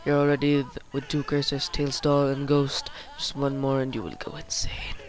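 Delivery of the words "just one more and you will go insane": low creepy whisper; sinister whispered warning; menacing whisper. Acted. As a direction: breathe the threat like a curse. Villain threat whispered.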